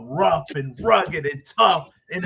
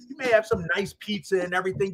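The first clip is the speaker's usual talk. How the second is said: ordinary talk, nothing marked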